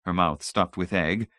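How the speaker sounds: ordinary delivery, nothing marked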